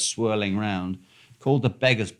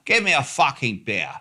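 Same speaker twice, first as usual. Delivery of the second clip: mock posh English demand; very posh accent; 3 semitones higher than usual. Storyteller mimicking the stranger's order at the bar, very posh accent. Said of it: "In a very posh accent".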